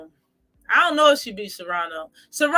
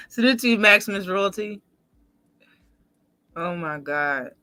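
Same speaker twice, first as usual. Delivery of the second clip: usual speaking voice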